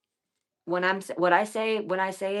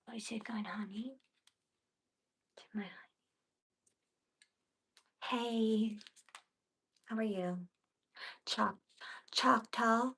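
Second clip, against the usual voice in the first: whispered, much softer, cooing to the dog; soft whisper to pet; 4 semitones higher, 9 dB quieter than usual. Real, not acted. Owner whispers sweetly to her dog honey, softly whispered. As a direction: breathe the words softly to your pet.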